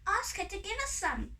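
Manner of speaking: high-pitched little fairy voice; eager high fairy; fairy sister. A narrator's reading. Fairy sister urges her on.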